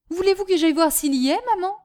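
high, fast, lightly voiced child; eager boy. Son asking mother to send him, eager. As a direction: offer keenly to run and check.

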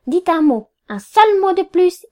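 high, loud warning; threatening. A narrator's reading. Lawyer silencing two women clients, threatening. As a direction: threaten them, leaning hard on the words. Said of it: "Cette terrible menace".

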